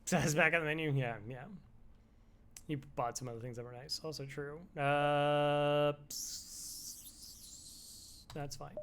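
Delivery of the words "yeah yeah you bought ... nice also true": usual speaking voice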